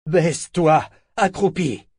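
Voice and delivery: loud, high barked command; furious order. A narrator's reading. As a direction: order her down angrily. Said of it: "ordonna-t-il furieusement".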